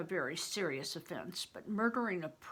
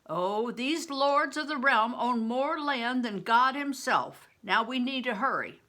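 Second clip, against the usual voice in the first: a louder, lightly voiced older companion; Bear, gruff companion; 5 semitones higher, 6 dB louder than usual. Bear warns the boy to hurry, louder and higher. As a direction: speak as a worldly protector, urging haste.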